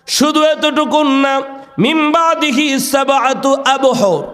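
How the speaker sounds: high, ringing sermon chant; preacher's high melodic call; impassioned preaching. Acted. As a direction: proclaim God's words high, easing down at the end.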